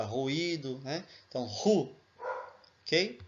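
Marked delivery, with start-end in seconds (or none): 1.5-1.9 "ru" com o R de "rua"; ru como em rua. Shown as a demonstration. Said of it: "na mesma entonação de rua". (ru como em ruído)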